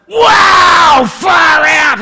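very high, very loud awestruck holler; amazed yell. Retold or imitated. Preacher re-enacting his revelation in the meeting, amazed yell. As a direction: belt it out as if struck by a revelation. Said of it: "suddenly it was like a revelation".

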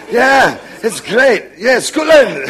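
drawn-out mock Scottish accent. Preacher imitating an Indian man's Scottish accent. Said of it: "says Scotland with a Scottish accent".

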